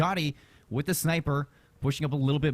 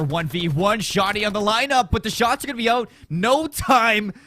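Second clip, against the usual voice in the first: esports caster hype; 9 semitones higher, 8 dB louder than usual. Real, not acted. Clutch round ends, caster builds hype.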